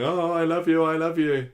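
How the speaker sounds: high, hammed-up declaration of love; mock Roy pleading. Roy pleading down the phone to Nina. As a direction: overdo Roy's desperate I love you. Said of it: "and Roy's going".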